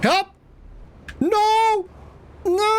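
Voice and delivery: high, loud mock panic cries; panicked yelling. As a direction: yell for help in fake terror. Planet falls apart, mock panic.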